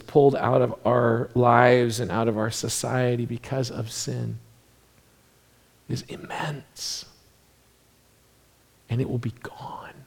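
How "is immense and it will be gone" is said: hushed whisper. Acted. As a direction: drop to a whisper. Preacher hushes for emphasis.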